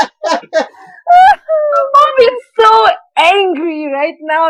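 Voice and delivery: slow words squeezed out through big laughter; laughing through words. Real, not acted. Telling friends about mom, laughing too hard. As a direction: barely get it out while laughing.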